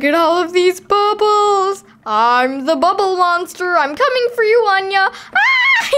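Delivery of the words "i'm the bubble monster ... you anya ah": playful monster. Pretend. Playing bubble monster.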